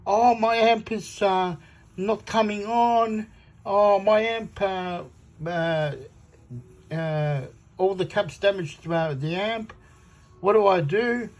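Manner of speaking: high, slow mock whine of a clueless forum poster; mocking a panicked amp owner asking for help, high whiny voice. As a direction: mimic a helpless forum newbie. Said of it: "doo-doo heads in the forum".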